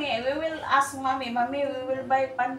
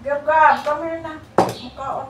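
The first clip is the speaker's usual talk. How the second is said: calling out; 5 semitones higher, 9 dB louder than usual. Real, not acted. Parent calling child over to eat, calling out loudly.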